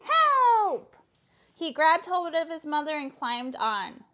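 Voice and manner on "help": high, loud cry for help. A narrator's reading. Froggy cries out in the pond, loud high yell. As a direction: yell it like a hammed-up drowning victim. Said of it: "cried Froggy".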